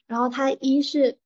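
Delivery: ordinary talk, nothing marked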